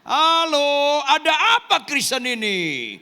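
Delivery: high, loud call to the congregation; challenging shout. Real, not acted. (seruan lantang menantang jemaat)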